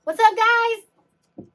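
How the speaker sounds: loud, high holler to the ghosts; mock calling out. Ghost hunter hailing ghosts on the trail, mock calling out. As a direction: shout a drawn-out greeting down the trail.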